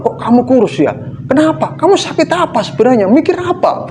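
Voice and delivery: high, fast nosy questioning voice; squeaky mock-concerned gossip; prying acquaintance. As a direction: mimic a busybody fussing over someone.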